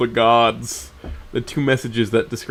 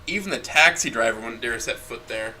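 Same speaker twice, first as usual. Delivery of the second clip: usual speaking voice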